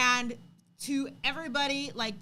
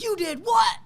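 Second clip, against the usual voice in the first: high, loud shocked outburst; shocked disbelief; 11 semitones higher, 12 dB louder than usual. Retold or imitated. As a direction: mom can't believe her ears. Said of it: "And I was like".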